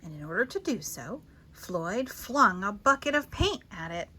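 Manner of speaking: usual speaking voice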